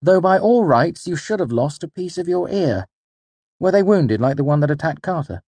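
high, loud character voice; Bast, questioning. A narrator's reading. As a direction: press him with a lively, high-pitched query. Voices Bast assessing the wounds.

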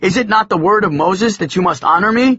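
high and loud little-father lecture; mock stern father. A narrator's reading.

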